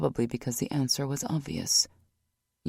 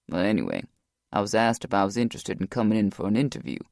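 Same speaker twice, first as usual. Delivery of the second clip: lower, steady male voice; Rock's voice; 6 semitones lower, faster than usual. A narrator's reading. Rock's lines in the audiobook.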